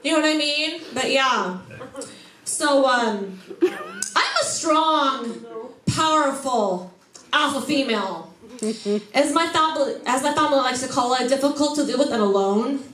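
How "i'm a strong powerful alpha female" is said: slow proclamation. Acted. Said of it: "slowed proclamation".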